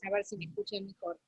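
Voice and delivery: faint and distant; phone held away. Real, not acted. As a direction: still too far from the mic. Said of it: "No, ahora te escucho".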